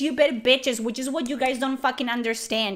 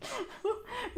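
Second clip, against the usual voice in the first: gloating laugh; 9 semitones higher, 14 dB quieter than usual. Real, not acted.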